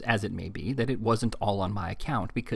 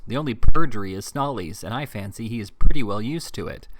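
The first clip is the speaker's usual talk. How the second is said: louder, hammering the point home; scornful; 13 dB louder than usual. A narrator's reading. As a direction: press him hard with scorn. Ralph scornfully pressing Squeers.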